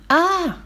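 high yelp of pain; cry of pain. A narrator's reading. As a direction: yelp as if you stubbed your foot. Hurts foot kicking things.

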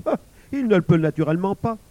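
studied, casual dismissal; feigned indifference. A narrator's reading. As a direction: brush off the question as obvious. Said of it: "avec une indifférence voulue".

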